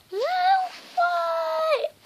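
sudden high yelp; startled squeal of surprise; shocked cry. Real, not acted. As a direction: caught off guard, crying out.

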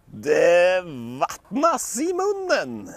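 slow, high relishing voice; mouth-watering. Acted. Lukas pretending to crave the bones, slow and savouring. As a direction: savour every word. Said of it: "säger Lukas".

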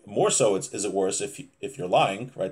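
ordinary delivery

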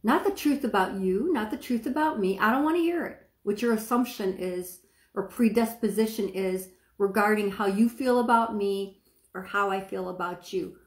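normal talking voice

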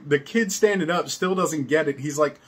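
normal talking voice

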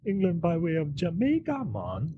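put-on English accent sliding into Jamaican; mock British-Jamaican accent. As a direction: imitate a badly faked Jamaican accent. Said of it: "what part of England are you".

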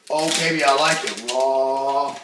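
low, slow mock seductive purr; lightly hammed-up come-on; mock sultry. Flirting with the protein powder, low slow mock seduction.

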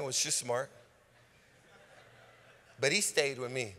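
ordinary talk

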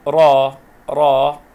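را held as a loud "raa"; long vowel. Shown as a demonstration. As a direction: draw out the a of را forcefully. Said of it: "مد بالألف".

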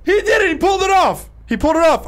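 high, excited shout; yelling in astonished delight; amazed cheering. Real, not acted. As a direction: cheer a player's unlikely win.

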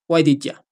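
"you" reduced to "ya" in "Why did ya?"; informal "did ya". Shown as a demonstration. Teacher shows students the super informal form, casually reduced. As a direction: run "did ya" together, ending on a short ah. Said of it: "esto ya sea un poco informal".

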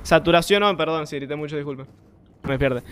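usual speaking voice

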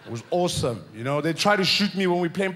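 normal talking voice

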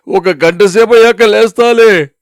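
high, whining plea to be left alone; groaning protest. Acted. Headachy husband to nagging wife, groaning protest. Sleepy husband begs to lie longer.

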